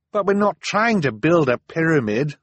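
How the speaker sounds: high, loud, exasperated Violet; exasperated cry. Acted. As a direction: cry it out, fed up with him. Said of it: "Violet cried in exasperation".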